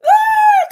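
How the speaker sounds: very high, loud exclaimed cry; loud shrieked "Ah". Real, not acted. Crowing over Kemi embarrassing them.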